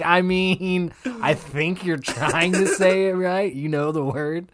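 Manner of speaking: patronizing. Retold or imitated. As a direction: humor him gently and slowly. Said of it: "It's like".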